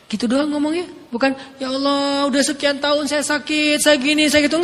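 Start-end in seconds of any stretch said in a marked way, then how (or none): 1.6-4.6 mock whiny, higher complaint to God; complaining prayer. Shown as a demonstration. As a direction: whine like someone grumbling about being sick. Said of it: "Bukan ... Enggak". (doa mengeluh yang dibuat-buat)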